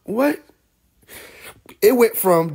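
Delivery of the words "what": high, rising, incredulous "what"; mock-stunned question; baffled disbelief. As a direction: act baffled at the remark. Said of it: "I'm like".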